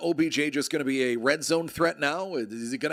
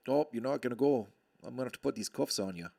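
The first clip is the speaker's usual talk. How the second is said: put-on Canadian accent; 4 dB quieter, faster than usual. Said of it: "As only a Canadian could".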